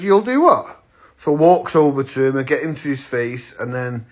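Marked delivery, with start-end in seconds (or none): 0.0-0.8 high-pitched, disbelieving comeback; retold squaring-up retort; incredulous challenge. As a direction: throw the threat back, voice shooting up. Retorting to a threat.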